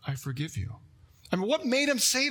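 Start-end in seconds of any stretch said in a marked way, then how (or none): 0.1-0.8 a teacher quietly forgives a student, gently; gentle forgiveness. Retold or imitated. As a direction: say it softly and sincerely. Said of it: "looked me in the eye".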